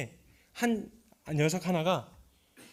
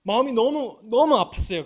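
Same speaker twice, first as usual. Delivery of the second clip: anguished preaching; 7 semitones higher, 11 dB louder than usual. Real, not acted. Preaching at full force.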